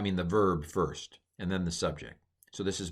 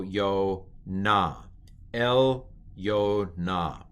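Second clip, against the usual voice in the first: very slow, syllable by syllable; model reading; much slower than usual. Shown as a demonstration. Teacher sounds out Yonah for learners, slow model reading. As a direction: stretch each syllable out.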